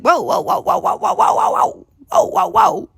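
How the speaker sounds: high-pitched rapid string of whoas; hammed-up "hold on" protest; alarmed mock protest. Halting the man's retold rant.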